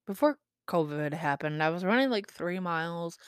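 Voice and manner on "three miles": normal talking voice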